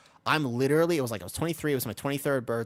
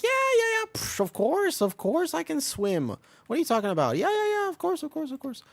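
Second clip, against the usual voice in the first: much higher, nervous fake-confident yeahs; overconfident bluff; 3 dB louder than usual. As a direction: brush it off too eagerly, covering a lie. Bluffing that he can swim.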